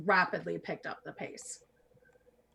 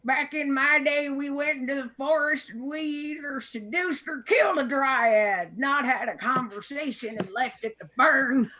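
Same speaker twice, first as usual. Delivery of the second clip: put-on higher, louder grumpy old-timer; cranky old man; 5 semitones higher, 3 dB louder than usual. Old man in rocking chair lecturing the party, cranky. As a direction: scold like a cranky grandpa. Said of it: "He goes".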